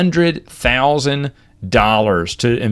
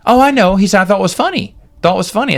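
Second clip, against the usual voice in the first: amused reassurance; 8 semitones higher, faster than usual. Retold or imitated. Manager reassures the joking teller.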